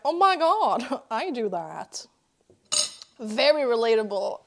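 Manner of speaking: normal talking voice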